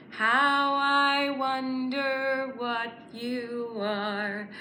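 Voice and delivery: ordinary talk, nothing marked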